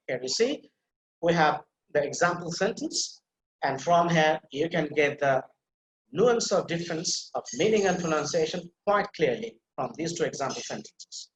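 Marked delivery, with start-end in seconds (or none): none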